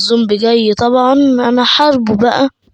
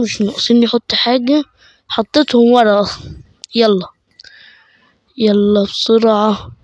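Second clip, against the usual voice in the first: usual speaking voice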